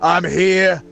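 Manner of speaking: big, booming bruiser soothing the crowd; huge hulking manner. Acted. Hulking fighter reassuring the scared crowd, booming. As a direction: puff up like a hulking fighter calming people down. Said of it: "talking in his huge fucking hulking manner".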